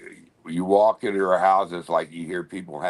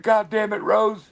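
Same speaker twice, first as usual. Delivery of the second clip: high, louder frantic yell; retold panicked yell; 12 semitones higher, 4 dB louder than usual. Holding the door against the pit bull, high yell. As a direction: yell at her from behind the door. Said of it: "and I said".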